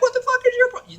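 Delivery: high-pitched put-on indignation; mock outrage. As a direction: blurt a pretend confrontation, cut short. Acts out reaction not to have.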